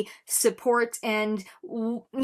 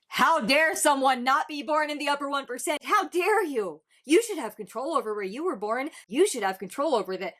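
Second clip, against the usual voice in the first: mock outrage, pitched up at the start; very sarcastic; 4 semitones higher than usual. Reactor mock-scolding people born outside the one percent, very sarcastic. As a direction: feign indignation with heavy sarcasm. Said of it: "I'm being very sarcastic here".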